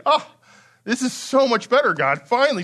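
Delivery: excited declaration. Retold or imitated. Preacher voicing Adam's delight at the woman, excited. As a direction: gush with relief like a man at last satisfied. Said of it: "this is an excited declaration".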